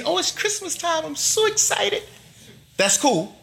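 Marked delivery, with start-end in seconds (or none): 0.0-2.0 high, gushing mock-giddy squeal; mock excitement. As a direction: play the giddy holiday shopper. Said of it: "however excited I get".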